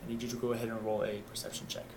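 ordinary delivery

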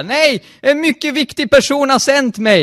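loud, high, desperate protest; frantic acted insistence; urgent insistence. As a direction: refuse to be put off, voice pushed way up. Acted insisting against her brush-off.

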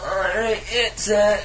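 high, lazy radio DJ voice; a bored radio DJ link; mock DJ voice. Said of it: "some D J that just goes".

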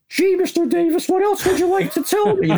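very high, gushing fan voice; starstruck fanboy. Retold or imitated. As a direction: squeak it out like a starstruck kid meeting his hero. Starstruck reporter on the phone.

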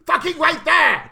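hammed-up loss of patience, high and loud; exasperated shout. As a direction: salesman suddenly yelling at the customer. Losing patience in acted salesman sketch.

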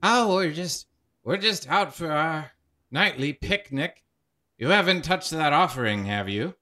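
a chatty spirit on a picnic; cheery ghost. Acted.

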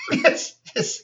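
high breathless giggling yes; squeaky laugh. Real, not acted.

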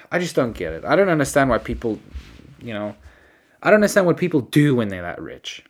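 usual speaking voice

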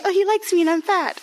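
squeaky high, teasing her younger self; high mock inner voice. Telling a podcast host her teen thoughts, high mocking voice. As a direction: play your lovestruck teen self, way up high.

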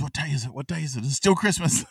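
high-pitched mock astonishment, rising to delight; excited awakening. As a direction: lightly act out waking up overjoyed. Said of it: "then he wakes up with Nova".

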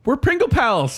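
high delighted squeal; excited. Real, not acted. Delighted matching chip rankings.

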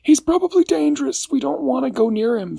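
high anxious voice; nervous character voice. A narrator's reading. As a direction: squeak it out like a jittery little friend. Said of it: "said Barnaby".

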